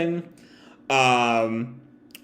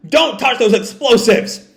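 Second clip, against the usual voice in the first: urgent shout; 8 semitones higher, 4 dB louder than usual. Acted. Yamato yells at Kazenbo.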